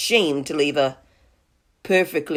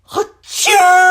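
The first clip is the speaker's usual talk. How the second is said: huge acted sneeze, very high and loud; great sneeze; 7 dB louder than usual. Wolf sneezing at the straw house, huge acted sneeze. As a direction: explode into a long drawn-out achoo. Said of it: "I sneezed a great sneeze".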